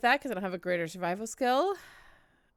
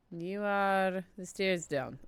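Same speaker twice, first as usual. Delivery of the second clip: usual speaking voice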